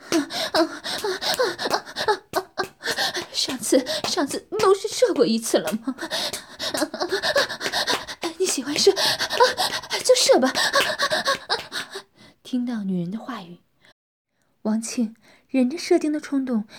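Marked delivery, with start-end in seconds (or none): 0.1-10.5 higher, louder coaxing moans; seductive moaning. Acted. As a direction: coax him on between moans. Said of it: "媚眼如丝地说". (媚声呻吟)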